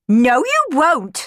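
slow, loud, high screech. Acted. Clorinda stopping Cenerentola serving the beggar, screech. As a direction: screech it to forbid her. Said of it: "screeched Clorinda".

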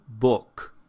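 "book" with a short, loosely rounded vowel; careful isolated "book"; horseshoe vowel. Shown as a demonstration. Teacher gives an example word, clear short vowel in "book". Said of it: "this is the sound in".

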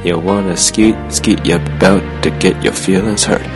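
normal talking voice

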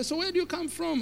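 high-pitched imitated elderly questioner; older passenger. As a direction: ask in a put-on high old person's voice. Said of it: "one of them would say".

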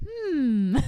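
hammed-up puzzled "hmm"; mock pondering. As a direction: hum as if playfully weighing up two people. Acts out puzzling over the couple.

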